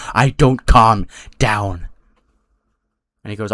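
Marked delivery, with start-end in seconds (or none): 0.1-1.9 slow, deliberate acted defiance; measured, stubborn mock menace; defiant refusal. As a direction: draw out each word as an acted threat. Enraged wrestler refuses to calm down.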